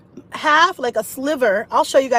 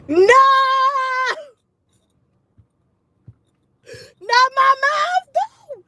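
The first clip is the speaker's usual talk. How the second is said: dismayed protest; 13 semitones higher, slower than usual. Retold or imitated. Protesting mom's comment.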